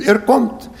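usual speaking voice

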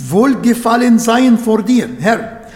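normal talking voice

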